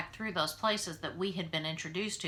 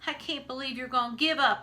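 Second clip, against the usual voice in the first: upset protest; 5 semitones higher, 5 dB louder than usual. A narrator's reading. Opal arguing with father.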